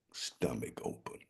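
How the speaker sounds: whispered, soft; hushed aside. A narrator's reading. Narrator telling the gruesome part, whispered. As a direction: drop to a whisper for the gore.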